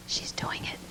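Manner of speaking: real hushed whisper; excited teacher whispering across the table. Real, not acted. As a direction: whisper it so the praying room won't hear. Peeking teacher whispering during prayer.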